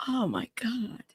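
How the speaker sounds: breathy whispered oh my God; shocked whisper. Real, not acted. Host reacting to the sobbing witness, shocked whisper. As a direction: barely voice it, stunned. Shocked by testimony being read.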